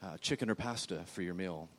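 soft, polite mock air hostess; quiet, courteous imitation of a flight attendant. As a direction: gently offer the meal like cabin crew. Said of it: "she was very politely saying".

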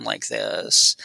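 low, creaking vocal fry; demonstrating a creaky, fried voice. Shown as a demonstration. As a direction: let the voice drop into vocal fry. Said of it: "vocal fry or creaky voice".

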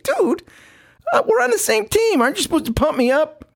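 high whine asking to be pumped up; whiny teammate retort. Retold or imitated. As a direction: act wounded by the teasing.

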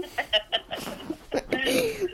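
bright high-pitched giggle; a quick amused laugh. Real, not acted. Laughs at own answer.

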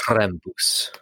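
playful German "Krampus" with an "oo" ending; German accent. Retold or imitated. Co-host insisting on the new name, German accent. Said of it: "you can only call him Krampus from now on".

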